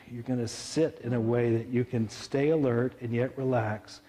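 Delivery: gentle guided meditation voice; calm grounding exercise. Acted.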